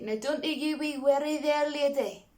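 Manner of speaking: hammed-up amateur Scottish accent; bad Scottish accent. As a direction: imitate a Scottish cartoon dog. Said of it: "I love his little Scottish accent".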